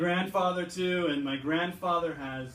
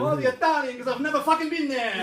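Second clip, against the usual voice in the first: high, loud hammed-up Italian accent, laughing through it; overplayed Italian-American bluster; mock Italian accent; 10 semitones higher, 9 dB louder than usual. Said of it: "the stronger the accent gets".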